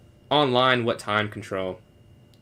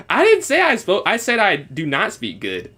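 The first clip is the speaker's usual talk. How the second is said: exasperated protest; 8 semitones higher, 6 dB louder than usual. Real, not acted. Correcting chat about his French.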